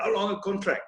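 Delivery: put-on light South African accent on "How long a contract?"; a hint of South African, lightly imitated. Imitating captain Eddie Barlow's question in a light South African accent. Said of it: "In his South African accent".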